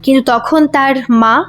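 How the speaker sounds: usual speaking voice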